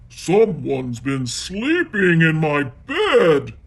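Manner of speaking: loud, slow grumbling Daddy Bear; Daddy Bear finds his bed rumpled, grumbling; grumbled. Acted. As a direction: grumble it heavily as the big bear. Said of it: "he grumbled".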